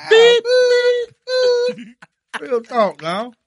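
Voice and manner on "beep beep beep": loud high beep beep; backing-up beeping. Pretend. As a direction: call out like a reversing truck. Mock truck backing up.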